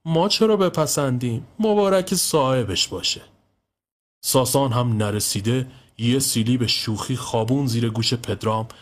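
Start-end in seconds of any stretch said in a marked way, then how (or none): 0.0-3.2 frowning, sulky. A narrator's reading. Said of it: "اخم کنان گفت".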